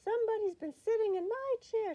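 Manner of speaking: high, loud middle-sized bear voice; Mama Bear. A narrator's reading. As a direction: pitch it well up, complaining loudly. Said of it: "in her middle-sized voice".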